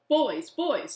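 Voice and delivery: put-on aunt calling out to the bickering boys; loud call to order. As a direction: break up the squabble. Aunt calming arguing boys.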